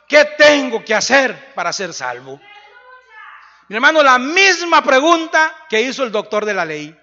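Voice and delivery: loud, rising preacher's climax; jailer's conversion preached loudly to the congregation; fiery preaching. Acted. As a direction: preach it out at full force, building. Preaching climax at full force.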